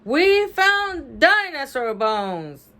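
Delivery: high, slow proclamation; loud voice. A narrator's reading. Bean telling the first grader about the bones, loud voice. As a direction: announce it slowly and emphatically. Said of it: "in a loud voice".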